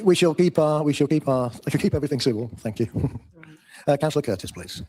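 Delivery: normal talking voice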